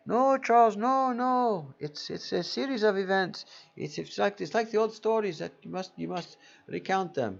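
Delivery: high, put-on Indian accent mimicking a friend; bad Indian accent. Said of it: "It's worse Indian accent".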